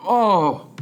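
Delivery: a burst of real surprise; surprised oh. Real, not acted. Hearing the pronunciation, surprised exclamation.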